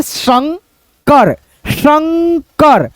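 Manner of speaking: शंकर very slow, stressing the half n before k; nasal n sound. Shown as a demonstration. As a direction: stretch शंकर to show the nasal ं. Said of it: "आधा नौ के रूप में होता है". (शंकर को धीरे, नाक से बोलें)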